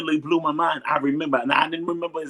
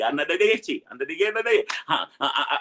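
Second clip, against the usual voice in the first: overcome stammer; 3 semitones higher than usual. Real, not acted. Overwhelmed again, words break down.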